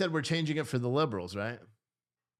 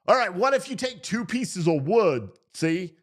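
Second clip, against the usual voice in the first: louder, higher put-on Southern accent; 3 semitones higher, 4 dB louder than usual. Man trying a second analogy with wood, mock Southern accent. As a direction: pitch it eagerly in a comic Southern accent. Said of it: "do it with a Southern accent".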